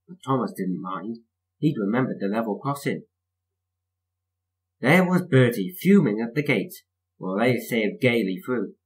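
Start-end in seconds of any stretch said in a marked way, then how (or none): none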